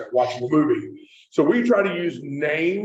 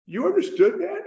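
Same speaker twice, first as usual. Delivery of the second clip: incredulous; 11 semitones higher than usual. Retold or imitated. Astonished woman questions the speaker.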